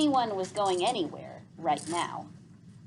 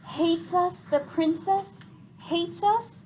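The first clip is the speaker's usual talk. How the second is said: worried; 10 semitones higher, 4 dB louder, slower than usual. A narrator's reading. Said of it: "asked Tick worriedly".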